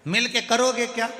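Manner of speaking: exasperated yell building to a high finish; shouting. Acted. Grandson to hard-of-hearing grandfather, shouting. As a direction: start firm, then shout the question high. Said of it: "नाना चिल्ला कर बोला".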